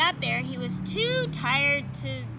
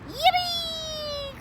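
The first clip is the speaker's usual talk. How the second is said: high, squealing cry of joy; yippee cry; 4 dB louder than usual. Acted. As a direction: shout it with delight. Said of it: "cried Froggy".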